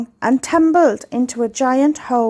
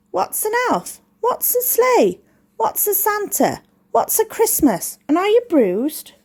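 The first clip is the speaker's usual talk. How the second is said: much higher, curious penguin questions; puzzled penguins; 8 semitones higher than usual. A narrator's reading. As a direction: ask high and baffled. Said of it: "They asked".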